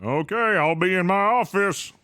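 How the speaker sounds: high put-on voice; character voice. In-game character reply.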